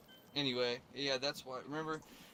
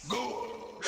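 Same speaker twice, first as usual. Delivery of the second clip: higher gruff battle cry; Piccolo voice; 9 semitones higher than usual. Retold or imitated. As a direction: bark it like Piccolo. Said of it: "you used to do Piccolo voice".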